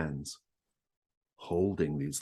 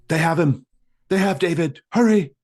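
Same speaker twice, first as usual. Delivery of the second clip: high, urgent shouted alarm; screaming; 13 semitones higher, 14 dB louder than usual. Acted. Priest bursting into the lobby to warn Cliff, screaming. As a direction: cry the news out at full volume. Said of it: "He's screaming".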